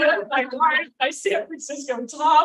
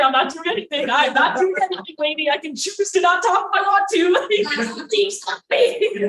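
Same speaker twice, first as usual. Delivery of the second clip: loud, rising comic protest; flustered protest; 6 semitones higher, 6 dB louder than usual. Retold or imitated. Drunk woman protesting to date, flustered and loud. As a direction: build higher and louder in mock desperation.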